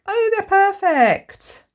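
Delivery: high, slow, loud gush of delight; excited. Real, not acted. Crafter gushing to viewers over sunflower stickers, thrilled. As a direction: swoon over the perfect stickers. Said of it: "Sorry, I'm getting all excited".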